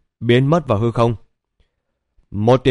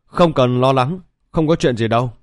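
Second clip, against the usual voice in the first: usual speaking voice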